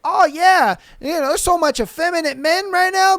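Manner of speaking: hammed-up gossip tone; mocking women. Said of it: "women walk around talking about".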